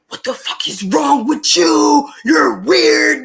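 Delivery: loud, furious mock yell; retold angry shout. Friend yelling at a creep who took upskirt photos, furious shout. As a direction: bark it like you're cussing a creep out. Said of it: "cussing this guy out".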